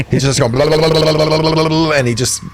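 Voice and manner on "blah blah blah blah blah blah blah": rapid, sustained babbling bass noise; imitated spinning bass. Describing the bassist going full salad spinner, imitating the bass. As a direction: babble on one raised note like a bass spinning. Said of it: "full salad spinner on his bass".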